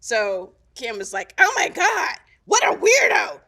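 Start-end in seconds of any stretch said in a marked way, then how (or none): 1.4-3.4 high, loud, scandalized imitation; hammed-up shout of scornful amazement; mock appalled outburst. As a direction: cry it out in exaggerated disbelief. Acts out Kim's scornful reaction.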